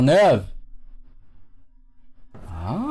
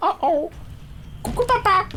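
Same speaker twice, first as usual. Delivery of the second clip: put-on high teasing kid voice; playful high voice; 3 dB quieter than usual. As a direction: squeak a cheeky hello. Voicing playful greeting.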